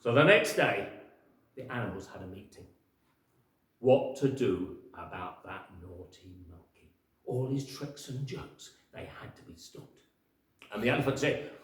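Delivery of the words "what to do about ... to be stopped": usual speaking voice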